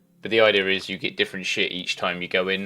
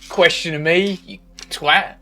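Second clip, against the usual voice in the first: mock outburst; 9 semitones higher, 8 dB louder, slower than usual. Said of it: "I was like".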